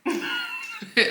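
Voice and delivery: breathless high-pitched giggle; a sudden burst of high laughter. Real, not acted.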